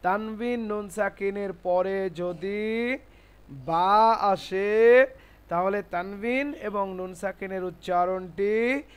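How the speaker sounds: slow, stretched phrase endings; teacher reciting a rule slowly for learners; slow dictation. Shown as a demonstration.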